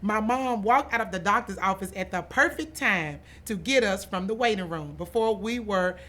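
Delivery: plain reading voice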